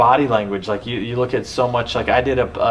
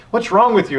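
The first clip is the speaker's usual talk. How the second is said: much higher, louder, fed-up cry; exasperated outburst; 11 semitones higher, 3 dB louder than usual. Retold or imitated. Rider losing patience with her horse, exasperated cry. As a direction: throw the question at him in frustration. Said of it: "I'm like".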